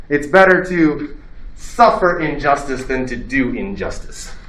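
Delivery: Socrates' principle. Retold or imitated. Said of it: "He's like".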